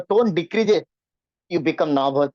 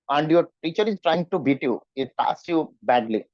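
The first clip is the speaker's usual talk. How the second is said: usual speaking voice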